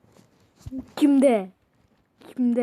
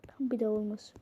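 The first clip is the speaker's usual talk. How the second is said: whispered aside; 5 semitones lower, 10 dB quieter, faster than usual. Real, not acted. Whispering an aside to the camera. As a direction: hush it under the breath.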